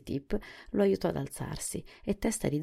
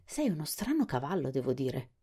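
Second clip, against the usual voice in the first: ordinary delivery, nothing marked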